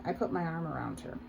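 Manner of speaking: ordinary delivery, nothing marked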